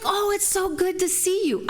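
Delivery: high, sweet, over-polite hello; sugary polite greeting. Retold or imitated. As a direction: gush a sweet fake-nice welcome. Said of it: "and I was like".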